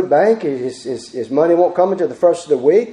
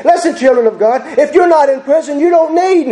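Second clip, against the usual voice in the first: high, raised preacher's voice; full-force preaching; 7 semitones higher than usual. Acted. Preacher urging church to help the needy, full-force preaching. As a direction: preach it out, pitched high and urgent.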